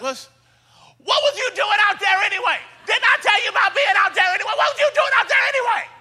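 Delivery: very high, rapid-fire mock rant; frustrated scolding. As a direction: imitate an exasperated father condemning his kid. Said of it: "he gets frustrated".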